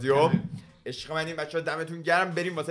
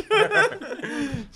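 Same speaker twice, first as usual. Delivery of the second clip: high-pitched burst of laughter; real laugh, pitched way up; 12 semitones higher than usual. Real, not acted. Friend laughing at his own loading story, high laugh.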